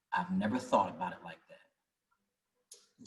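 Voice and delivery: quiet, slow retold realization; moved. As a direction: hush it like a man close to tears. Old man moved to tears.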